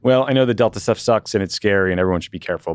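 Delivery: usual speaking voice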